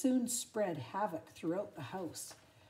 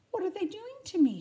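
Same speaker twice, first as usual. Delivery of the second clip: high, fast frightened cry; panicked; 12 semitones higher, 8 dB louder, faster than usual. Acted. Lady being muzzled, high frightened panic. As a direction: blurt it out in panic.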